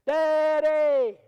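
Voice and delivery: put-on high, loud child's shout; excited little boy's yell; kid yelling. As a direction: call across the airport to Dad. Little son yelling at airport.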